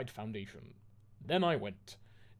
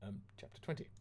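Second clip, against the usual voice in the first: whispered, much softer heading; softly whispered chapter title; 3 semitones lower, 10 dB quieter, faster than usual. Shown as a demonstration. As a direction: hush it out like an aside.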